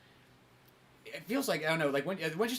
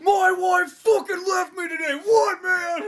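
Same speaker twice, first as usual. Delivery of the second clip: high, drawn-out wailing shout; hammed-up meltdown of an angry man; mock anguished outburst; 13 semitones higher, 6 dB louder, much slower than usual. Acts out distraught angry driver.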